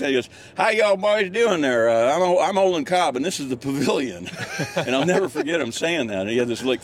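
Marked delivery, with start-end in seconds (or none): 0.5-4.3 put-on Olin Cobb's Southern accent. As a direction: greet the boys as a friendly Southern gentleman. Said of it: "thick Southern accent".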